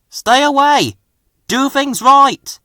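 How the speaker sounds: warning call. A narrator's reading. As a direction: call them back urgently. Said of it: "he called to them".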